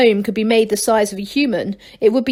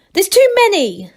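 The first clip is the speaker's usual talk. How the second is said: protesting; 10 semitones higher, 5 dB louder than usual. A narrator's reading. Gerda protested.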